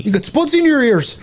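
put-on Irish accent. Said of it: "teaching Alexis how to do an Irish accent".

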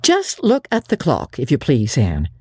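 a hint of a dry, stern elderly woman; stern Marilla. Marilla warning Anne about work, mildly stern. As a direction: lay down the rules firmly. Guardian rebukes lateness.

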